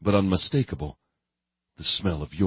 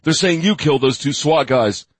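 tense, raised male voice; urgent character voice; 9 semitones higher, 5 dB louder than usual. Acted. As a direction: deliver bad news urgently. Character voice in audiobook.

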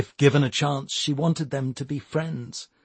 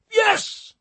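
high, triumphant Cypriot boss; 4 dB louder than usual. A narrator's reading. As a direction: crow the word in triumph. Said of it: "said Mister Fiacca, triumphantly".

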